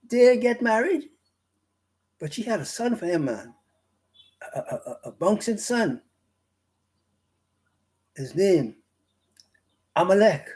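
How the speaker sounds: animated Bahamian dialect with a high start; Bahamian broad English. Shown as a demonstration. Preacher telling of Timnah's baby as locals would, broad Bahamian English. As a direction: tell it like Bahamian gossip. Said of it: "That's how they say it".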